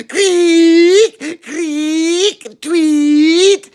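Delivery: very high, loud mock birdsong answering back; imitated bird call. Horace imitating the bird to spook it, very high chirps. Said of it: "and Horace Bedority says".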